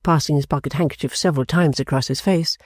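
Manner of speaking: ordinary delivery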